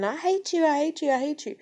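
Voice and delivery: high mock tantrum yell; imitating a screaming kid. Mom imitating her screaming kid, in a high mock yell. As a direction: play the kid shrieking I hate you. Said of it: "starts screaming".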